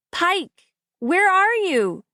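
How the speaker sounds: high, slow searching shout. A narrator's reading. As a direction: shout into the woods for him. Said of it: "I shout".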